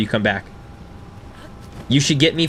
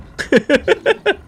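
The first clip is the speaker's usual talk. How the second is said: high giggle; 4 dB louder than usual. Real, not acted. Laughing after saying no.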